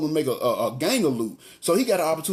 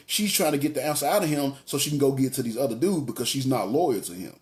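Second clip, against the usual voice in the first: usual speaking voice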